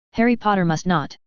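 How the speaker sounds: plain reading voice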